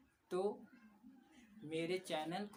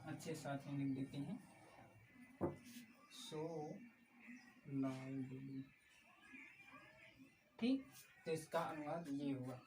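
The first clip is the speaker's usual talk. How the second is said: ordinary talk, nothing marked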